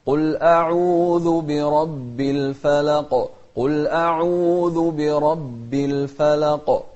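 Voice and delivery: loud, slow chanted "الفلق" ending on a bouncing qaf; qalqalah. Shown as a demonstration. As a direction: recite strongly, popping the final qaf of "الفلق". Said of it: "من أحرف القلقلة". (ترتيل جهوري مع قلقلة قاف "الفلق")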